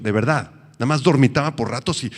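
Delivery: ordinary delivery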